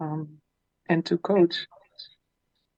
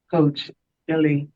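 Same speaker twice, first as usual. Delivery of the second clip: ordinary delivery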